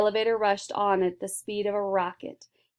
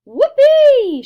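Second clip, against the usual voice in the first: very high, loud whoop; joyful shout; 17 dB louder than usual. A narrator's reading. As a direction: yell it out with glee. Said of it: "shouted Mike T V".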